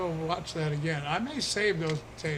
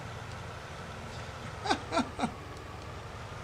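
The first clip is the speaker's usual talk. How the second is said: high breathy laugh; 11 semitones higher, 7 dB quieter than usual. Real, not acted. Laughing at the joke.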